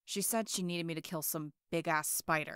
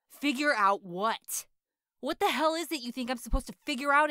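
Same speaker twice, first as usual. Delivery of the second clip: furious, much louder shouting; hot-tempered rant, shouted; angry yelling; 3 semitones higher, 7 dB louder than usual. Acted. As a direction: yell threats through the locked door. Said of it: "when I was yelling at Raccoon Eyes".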